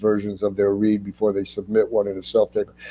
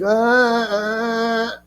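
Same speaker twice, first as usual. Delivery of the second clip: much higher mock maniacal cackle; maniacal laugh; 12 semitones higher than usual. Actor on his first day inciting a riot, a hammed-up maniacal laugh. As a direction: imitate the wild laugh from the first set day. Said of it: "I laughed maniacally".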